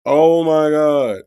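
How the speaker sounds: slow, loud, drawn-out oh my God; stunned disbelief. Real, not acted. Host floored by the jail call, slow and loud. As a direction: drag it out, floored.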